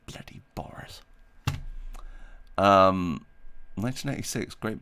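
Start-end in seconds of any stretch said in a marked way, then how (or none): 0.1-1.0 hushed exasperated whisper; whispered grumble. Real, not acted. Owner grumbles at the cat under her breath, whispering. Muttered complaint about pet.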